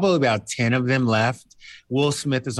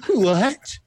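high surprised what; 8 semitones higher than usual. Retold or imitated. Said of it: "he was like".

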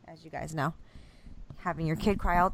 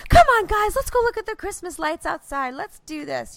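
high-pitched over-bright mom pep; hammed-up switch into peppy enthusiasm; sudden manic cheer; 11 semitones higher, 4 dB louder than usual. Mom rallying crying kids to see lights, manic cheer. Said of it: "super bipolar mode was like".

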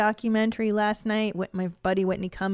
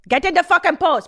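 higher, louder repeat of the order; mock furious shout; 9 semitones higher, 9 dB louder than usual. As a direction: yell the order at the student. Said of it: "chastising this woman".

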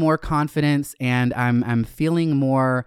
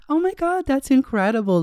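mock excitement; 11 semitones higher than usual. Pretend reply to imagined listeners.

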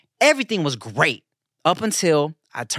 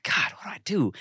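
unvoiced whisper in the dark; 6 dB quieter than usual. Father whispering in the dark nursery, worried. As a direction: whisper so the baby won't wake.

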